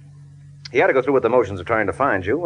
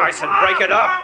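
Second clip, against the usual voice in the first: firm shout. Acted. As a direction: bark the order twice as hard. Mike pulling men apart.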